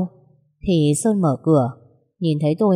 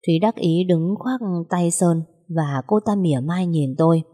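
ordinary delivery, nothing marked

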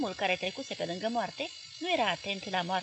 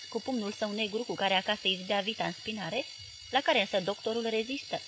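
ordinary delivery

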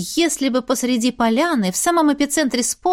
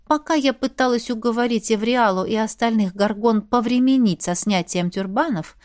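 ordinary delivery

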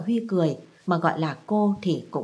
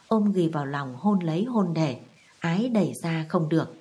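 ordinary delivery

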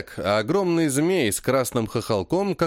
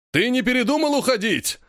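much higher, booming shout; shouting in deep bass; 10 semitones higher than usual. A narrator's reading. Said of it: "кричит мощным басом Иван".

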